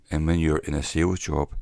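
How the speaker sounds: usual speaking voice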